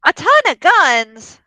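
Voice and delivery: high, loud, drawn-out disbelief; astonished echo. Acted. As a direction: echo it back loudly, stretching every word. Echoes surprising news in play.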